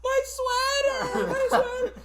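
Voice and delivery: put-on high, slow whining kid's voice; mock whiny kid. Mocking the kid crying over his thrown sweater, high whine. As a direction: drag out a childish wail.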